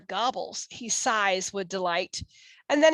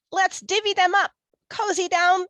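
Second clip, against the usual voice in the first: high, quick, grinning badger voice; 9 semitones higher, 4 dB louder, faster than usual. A narrator's reading. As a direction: say it fast with a grin. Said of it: "Badger says with a grin".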